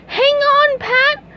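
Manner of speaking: high squeaky sponge cry; SpongeBob cry. Acted. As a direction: yell it high to your friend. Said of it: "cried SpongeBob".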